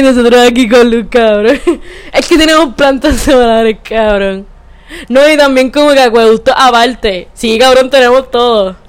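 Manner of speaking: lightly voiced smug Adjuntas local; mock bragging neighbor. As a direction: gloat through laughs about having power. Mocking Adjuntas folks bragging about power.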